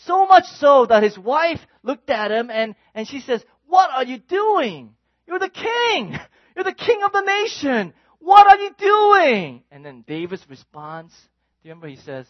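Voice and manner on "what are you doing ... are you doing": high, rising to a loud shout; appalled scolding. Acted. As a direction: scold the king in disbelief. David's wife scolds the dancing king.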